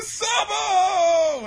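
high, loud wail of recognition; exaggerated shocked, weepy yell; mock tearful shout. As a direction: cry out the name, hammed-up. Luffy recognises his lost brother.

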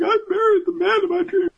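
very high falsetto voice; hammed-up dreamy squeal; gushing bride. Bride gushing over her groom, squealing falsetto.

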